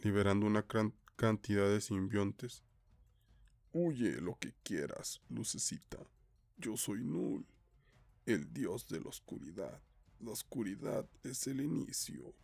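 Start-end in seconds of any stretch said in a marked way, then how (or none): 3.7-12.3 hushed, menacing dark god. A narrator's reading. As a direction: speak softly as the god of darkness. The dark god Null speaks.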